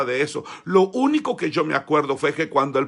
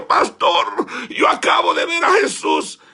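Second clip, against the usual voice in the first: high, loud tearful exclaiming; excited boy; 7 dB louder than usual. Retold or imitated. Boy running to the pastor, crying out loud. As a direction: cry it out, overjoyed. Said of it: "fui corriendo al pastor".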